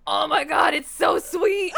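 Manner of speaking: loud, slow outburst over sweetness; shocked exclaim. Retold or imitated. Coworker tasting maple candy, loud slow shocked exclaim. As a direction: shout it like the sweetness shocked you.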